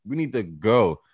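ordinary talk

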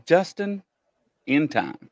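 JUSTIN, a pause, then IN TIME; name split apart. Shown as a demonstration. Host sounding out a viewer's screen name, split apart. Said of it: "You said that real fast it sounds like".

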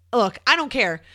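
ordinary talk

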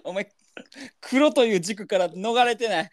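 high, slow words through laughter; real helpless laughing protest; laughing retort. Real, not acted. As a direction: crack up while protesting. Laughing retort at partner's line.

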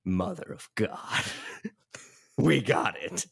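a hint of a stunned studio head; lightly voiced boss, breathing out in wonder; awed boss. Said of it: "looks up from his desk and goes".

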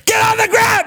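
mock guard's yell to get down; imitated shout. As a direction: bark it like an armed guard. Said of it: "being like".

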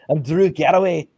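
lightly Scottish wrestler intro, laughing; a hint of a Scottish brogue; Scottish accent. Host slips into a mild Scottish accent. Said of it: "in a Scottish accent".

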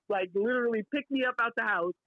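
usual speaking voice

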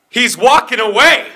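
high, indignant mock yell; retold outburst. Irate customer complaining to store worker, mock outburst.

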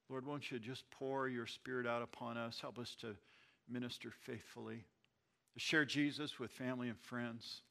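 soft, hushed prayer voice. Acted. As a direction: pray it quietly to the Lord. Leads the closing prayer.

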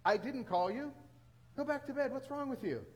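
mock annoyed, pitch jumping up; exasperated priest. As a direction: scold the boy who woke you. Priest irritated at being woken twice.

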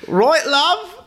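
high, hammed-up British accent; cheeky Cockney British accent. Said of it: "That's the extent of my British accent".

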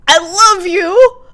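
hammed-up slow gush; gushing declaration. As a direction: ham up the love, slowly. Acting out a quiz option.

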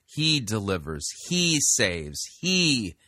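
"He" drawn out and punched to open each phrase; stress on He. Shown as a demonstration. Host contrasts the psalm's focus on the Lord, stressing "He". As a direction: lean on every "He" as the counterpoint. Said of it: "You see the difference?".

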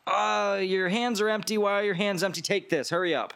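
high, hurried server snapping orders; bossy head server. Acted. Head server hurrying along a server with empty hands, high and bossy. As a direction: rush the new guy like a frantic head waiter.

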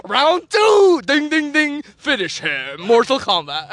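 hammed-up video-game round call with bell; game announcer. Parodies fighting game announcer.

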